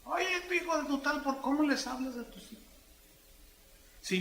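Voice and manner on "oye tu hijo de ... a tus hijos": high, put-on voice of a crude parent; mock rough parent. Preacher mimics a crude parent scolding his children. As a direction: ham up a parent snapping at his kid. Parent talking rudely to kids.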